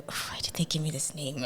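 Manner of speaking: hushed, quick mock lament; whispered retold grumble; muttered complaint. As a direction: mutter it fast under your breath, almost whispered. Retells own reaction to pressure.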